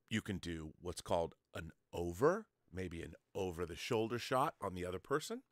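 ordinary talk

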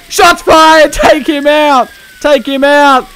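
loud, high yell through a laugh; excited shout. Pretend. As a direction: shout orders at your team. Calling out a police shootout.

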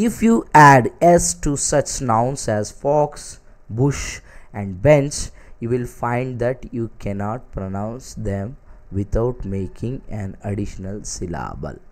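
slow, deliberate textbook reading; reading aloud. Acted. Teacher reads the special note to students, slow reading aloud. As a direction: read it out word by word, very slowly.

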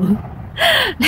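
short high-pitched laugh. Real, not acted. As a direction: let out a quick high giggle. Laughs after quoting a customer's text. (cười khúc khích giọng cao)

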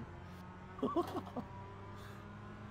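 high, soft, voiceless laughter; wheezing laugh. Real, not acted. Racer cracks up at spinning tires, wheezing laugh. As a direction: laugh until only air comes out.

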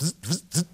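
imitated buzzing of a dying projector. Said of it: "the projector went".

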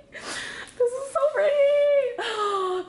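very high, stretched-out tearful squeal; overwhelmed high-pitched wail of delight; tearful joy. Real, not acted. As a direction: squeal it slowly through happy tears. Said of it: "I'm literally tearing up".

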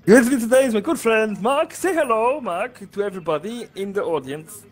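high-pitched Italian streamer voice; Italian accent. Acted. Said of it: "This time I'm, uh, Italian".

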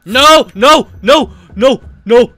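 screaming no, fading to breathless; frantic screams while fleeing; being chased. Real, not acted.

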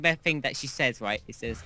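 ordinary talk, nothing marked

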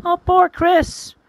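high, drawn-out sympathy; pitying. Acted. Rosie learning Chris was tricked, pitying. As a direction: stretch it out high with pity.

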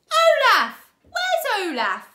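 loud, high, odd theatre-troupe voices; calling troupe. A narrator's reading. Troupe members calling for Olaf, loud and high. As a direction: call out rowdily from the next room. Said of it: "voices called out".

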